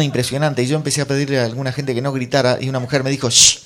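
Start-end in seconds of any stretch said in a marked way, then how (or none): 3.3-3.6 loud, sharp shush; imitated shushing. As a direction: hush a noisy cinema audience. Mujer manda callar en cine. (chistido fuerte para hacer callar)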